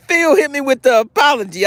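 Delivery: high laughing exclaim. Real, not acted. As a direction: squeal it through a laugh. Found Phil's missed apology.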